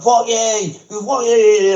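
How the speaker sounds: high, mock posh British lad going off; a hammed-up English schoolboy impression; British Harry Potter voice. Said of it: "he sounded like Harry Potter".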